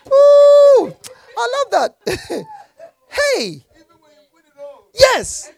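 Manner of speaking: high, slow, delighted ooh; gleeful delight. Real, not acted. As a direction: savour the line with joy. Delighted by the scripture.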